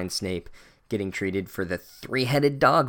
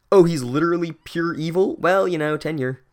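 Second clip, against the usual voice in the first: higher, louder put-on voice shrugging it off; mock shrugging official; 6 semitones higher, 6 dB louder than usual. Reviewer voicing the school excusing the evil teacher, mock-official. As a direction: brush off evil like a bored administrator.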